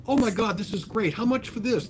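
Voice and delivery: high-pitched eager admiration; mildly acted-out enthusiasm; retold delight. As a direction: imitate an excited buyer, voice lifted.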